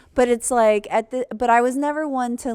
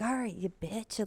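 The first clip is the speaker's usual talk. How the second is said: dad muttering an insult to his daughter, softly; under his breath; 11 dB quieter than usual. Retold or imitated. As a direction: mutter it so only she hears. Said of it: "under his breath or something".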